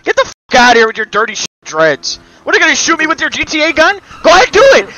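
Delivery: high, loud furious rant; ranting shout at a player in the game; angry yelling. Real, not acted. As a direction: scream him down.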